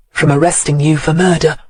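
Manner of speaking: ordinary delivery, nothing marked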